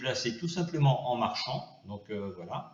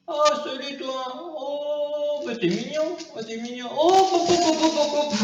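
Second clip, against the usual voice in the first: put-on high squealing baby talk to a pet; cooing baby talk; 13 semitones higher, 4 dB louder than usual. Greeting the new alien pet, high cooing baby talk. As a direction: coo at the fluffy creature, delighted.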